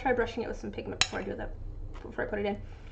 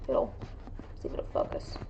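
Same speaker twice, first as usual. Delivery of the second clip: whisper; 7 dB quieter than usual. Real, not acted. Muttering to herself.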